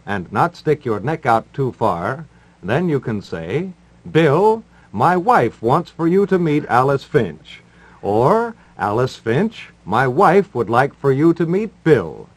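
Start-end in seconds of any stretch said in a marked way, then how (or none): none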